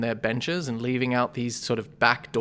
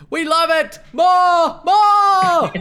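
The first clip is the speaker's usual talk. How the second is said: very high, loud cheering crowd; 10 dB louder, much slower than usual. Acted. The crowd cheers the goggle pitch, very high loud shouts. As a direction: yell it out like a delighted crowd. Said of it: "the rest of the crowd says".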